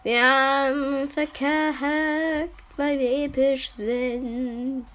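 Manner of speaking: drawn-out. Real, not acted. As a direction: drag each word out slowly. Repeats the line, drawn out.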